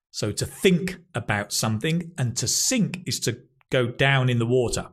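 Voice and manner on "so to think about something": ordinary delivery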